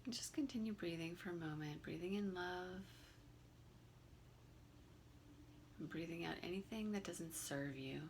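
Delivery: calm, unhurried meditation guide with long pauses; guided meditation. Real, not acted. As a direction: gently lead them through the breathing, leaving room between phrases.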